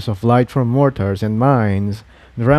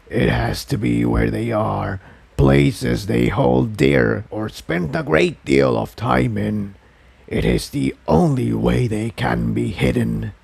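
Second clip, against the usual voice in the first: inner voice. A narrator's reading. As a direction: urge him on like a hidden presence. The inner voice answers.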